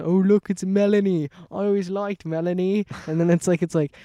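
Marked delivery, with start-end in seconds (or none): 0.0-2.9 raised, mock British voice; British accent. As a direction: imitate a weird British dude's sound bite. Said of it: "in his British accent".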